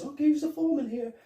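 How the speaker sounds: high, fast put-on official voice; mock inspector barking orders; bossy inspector. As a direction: order the workers around in a squeaky fake authority. Imitating Foley as inspector.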